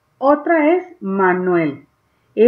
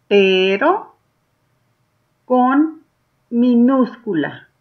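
slow, word-by-word read-aloud with long pauses; slow, clearly separated teaching read; reading aloud together; 2 semitones higher, slower than usual. Shown as a demonstration. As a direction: lead beginners through it one word at a time. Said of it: "lean conmigo en voz alta".

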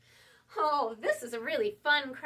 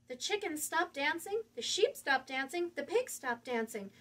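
ordinary delivery, nothing marked

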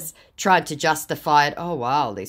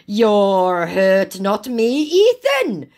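loud, high, climbing to the last word; hammed-up indignant comeback; loud scammer retort; 5 semitones higher, 7 dB louder, much slower than usual. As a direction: fire it back as the scammer, peaking at the name. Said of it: "your eardrums going".

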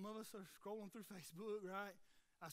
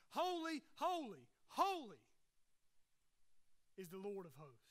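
raised, punched cry on each word; emphatic angelic repetition; 3 semitones lower than usual. Acted. Angels repeating it for good measure, emphatic proclamation. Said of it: "notice what the angels do here".

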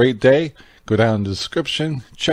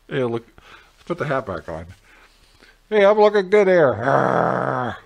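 muffled behind mask; speaking while wearing mask; talking through a face mask; 2 semitones higher than usual. Real, not acted.

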